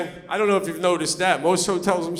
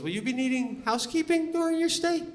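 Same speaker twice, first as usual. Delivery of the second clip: high, soft customer-service question; front desk lady; 7 semitones higher, 7 dB quieter than usual. Retold or imitated. Hotel lady asking a guest at check-in, lightly imitated clerk voice. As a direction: lightly mimic a hotel clerk, pitched up and quiet. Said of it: "the lady goes".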